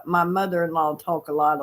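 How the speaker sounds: ordinary delivery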